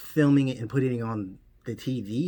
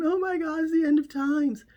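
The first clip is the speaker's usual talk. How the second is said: hammed-up shriek far higher in pitch; terrified screaming; 4 dB louder than usual. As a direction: wail it like the world is ending. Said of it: "and they were screaming".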